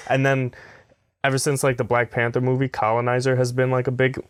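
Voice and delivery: usual speaking voice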